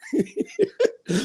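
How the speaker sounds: short high-pitched giggle; real quick giggle, pitched high; high chuckle. Real, not acted. Laughs before moving on.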